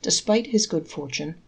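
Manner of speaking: ordinary delivery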